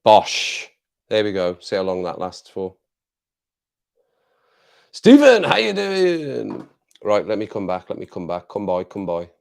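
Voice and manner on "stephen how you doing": high, loud hail of the name; calling out greeting. Real, not acted. Streamer spotting a viewer in chat, loud high greeting. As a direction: call the name out gladly across the room.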